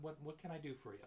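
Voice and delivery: usual speaking voice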